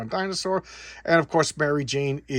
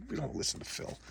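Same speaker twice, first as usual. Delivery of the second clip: whispered aside; 14 dB quieter than usual. Acted. Joking aside about Phil.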